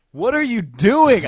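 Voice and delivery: loud, high, drawn-out disbelief; exasperated protest. Retold or imitated. Man woken by his girlfriend's kisses, exasperated protest.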